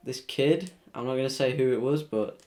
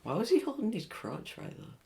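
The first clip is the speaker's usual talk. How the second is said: incredulous; 10 semitones higher, 5 dB quieter than usual. Real, not acted. Sudden reaction to the game.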